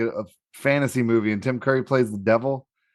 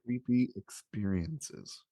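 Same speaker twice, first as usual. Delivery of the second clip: slow, hushed spooky repeat; spooky echo; 12 dB quieter, much slower than usual. Pretend. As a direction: drag it out like a ghost story. Echoing topic in spooky tone.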